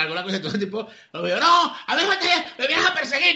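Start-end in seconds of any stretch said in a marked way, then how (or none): 1.4-3.3 chillido alarmado de quien se siente perseguido; grito alterado, imitado. Retold or imitated. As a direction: imita un grito muy agudo y fuerte. El tipo alterado grita al verlo seguirlo. (gritando alterado, en imitación)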